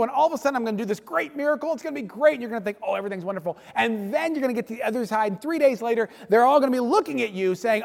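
fast, high mock-enthusiastic rant; heavy sarcasm. Moses recounting the Red Sea plan, sarcastically gushing. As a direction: rattle off the disasters as if they were great news. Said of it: "an inordinate amount of sarcasm".

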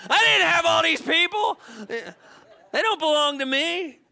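hammed-up Moses protesting with a laugh; exasperated Moses, laughing. Moses complaint paraphrased.

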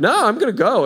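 high, loud, excited refusal; acted insistence. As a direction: imitate someone cheerfully determined to leave. Acts patient insisting on leaving.

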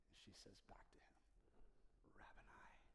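unvoiced hush; whisper. Real, not acted. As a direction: breathe it in awe.

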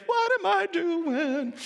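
drawn-out disbelief; bewildered Peter. Acted. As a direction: Peter suddenly realising what he is doing.

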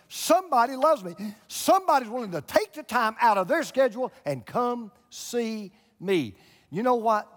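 rising from firm to loud, high preaching; building preaching. Acted.

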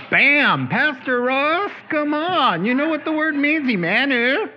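God playfully telling Pastor Ross to wake up, high and loud. Retold or imitated. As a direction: announce it like God nudging the pastor. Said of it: "the Lord just went".

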